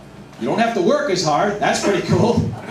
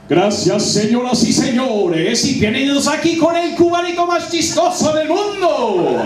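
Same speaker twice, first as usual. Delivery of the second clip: booming Spanish announcer; 8 semitones higher, 3 dB louder than usual. Pretend. Comic introducing himself to the crowd as a grand Spanish announcer. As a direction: boom out his own intro like a boxing announcer. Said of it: "I like to announce it like this".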